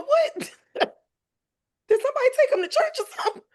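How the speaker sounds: high, laughing disbelief; stunned disbelief. Retold or imitated. Mom retelling her shock at her son's words, laughing disbelief. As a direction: squeal it, half laughing, can't believe it.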